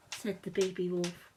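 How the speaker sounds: plain reading voice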